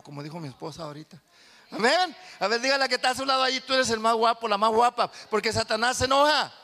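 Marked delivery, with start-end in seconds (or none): 1.7-6.5 loud, high call to the congregation; rallying preaching. Acted. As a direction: rouse the church to answer.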